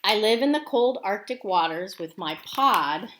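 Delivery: narwhal voice. Acted. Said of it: "He's saying".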